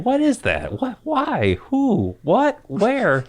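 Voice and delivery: high-pitched, mock-bewildered string of questions; puzzled listener. Host voicing listeners wondering about the news, mock puzzled. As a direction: pitch each question up high, playing baffled.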